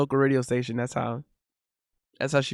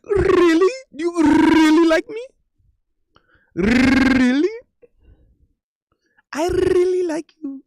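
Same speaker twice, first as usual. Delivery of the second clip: high, hammed-up girl's voice; girlish voice imitation; 13 semitones higher, 5 dB louder, slower than usual. Man imitating a girl he talked to in a high voice. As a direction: squeak it like the girl did. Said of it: "that was exactly how she talked like".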